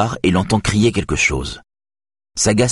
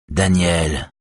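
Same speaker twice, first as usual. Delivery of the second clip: grave voice. A narrator's reading. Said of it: "d'une voix grave".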